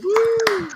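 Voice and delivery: very high "woo" of applause; high cheering whoop. Real, not acted. Host cheering a comedian off, high whoop. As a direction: whoop for the act who just finished.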